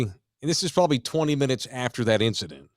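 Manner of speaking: ordinary talk, nothing marked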